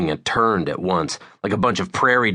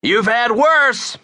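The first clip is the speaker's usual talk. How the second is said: loud, high, stretched-out holler; shouted comeback; 6 dB louder, slower than usual. A narrator's reading. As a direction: holler a cheeky reply across the street. Shouting back across the street.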